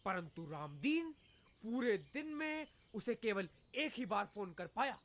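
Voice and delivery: mock newsreader delivery; news read.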